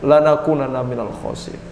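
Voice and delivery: low, mildly mournful recitation; hushed penitent's prayer, no real sobs; acted tearful prayer. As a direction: intone a remorseful plea, lightly acting the weeping.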